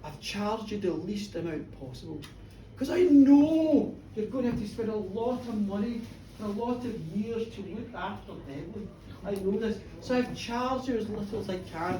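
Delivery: low, soft, patient explanation; gentle reasoning; soft calm doctor. Retold or imitated. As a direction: quietly justify the bill. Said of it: "The doctor goes".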